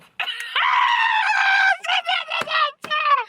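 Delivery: long, very high cackle; shrieking laugh. Real, not acted. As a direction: crack up in a long squeal. Cracks up after counting two-step.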